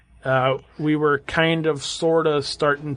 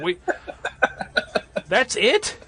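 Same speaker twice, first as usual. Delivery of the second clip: disbelief; 9 semitones higher, slower than usual. Retold or imitated. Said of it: "You're like".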